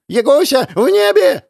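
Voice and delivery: calling out. A narrator's reading. Cat calls out to Yaga.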